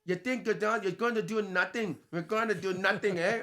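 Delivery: cocky fighter's taunt in put-on Irish brogue; Conor McGregor. Shown as a demonstration. Co-host does fighter on request, high Irish accent. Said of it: "do a Irish accent. Do Con-, Conor McGregor".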